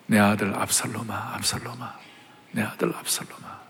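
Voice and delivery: hushed, broken whisper of a grieving father; a father's whispered lament over his dead son. Acted. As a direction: breathe out the lament almost silently. David mourns his son, whispered.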